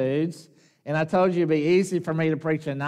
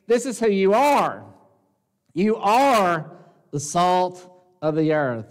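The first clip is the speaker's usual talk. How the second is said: raised, louder declaration, "You are" hit hardest; firm declaration; 3 semitones higher, 4 dB louder than usual. Retold or imitated. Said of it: "No, he's saying".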